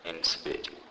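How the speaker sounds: normal talking voice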